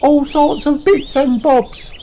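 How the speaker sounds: very high fairground call; high singsong hawker cry; Emily Elephant calling. A narrator's reading. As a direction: call out like a tiny stallholder. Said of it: "she calls".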